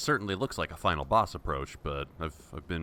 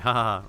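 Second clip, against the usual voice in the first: loud, higher "ha ha ha"; mock taunting laugh; 5 semitones higher, 7 dB louder, faster than usual. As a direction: laugh tauntingly at helpless foes. Taunts enemies while invincible.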